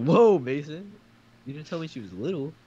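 high-pitched startled reproach; mock shock. Mock shock at friend's remark.

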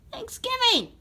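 THANKSGIVING squealed very high; excited squeal. Acted. Red Yoshi celebrates tomorrow's holiday to Mario, excited squeal. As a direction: cry out the holiday in squeaky delight.

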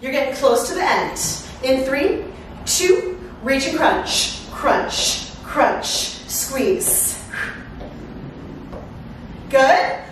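normal talking voice

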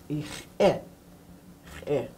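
"ich eh" with a clear catch before "eh"; teacher's demo; glottal stop. Shown as a demonstration. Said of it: "Makes a different sound".

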